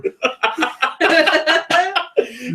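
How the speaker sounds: real high-pitched burst of laughter; cracking up, can't hold it in; high helpless laugh. Real, not acted. Host losing it at a co-host's joke, high laugh.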